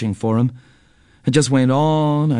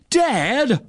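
high-pitched call for a parent; narrated shout, held back in volume; 10 semitones higher than usual. A narrator's reading. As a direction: call out with pitch raised, not much volume. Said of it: "I shouted at nothing".

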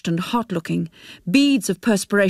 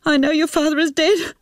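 high, tearful wail; crying through the words; shuddering sobs; 12 semitones higher than usual. Acted. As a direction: sob it out in a high-pitched, anguished voice. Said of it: "She burst into shuddering sobs".

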